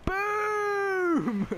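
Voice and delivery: a loud, high yell of triumph; triumphant boom. Real, not acted. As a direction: gamer yelling boom after a goal. Scoring, shouting.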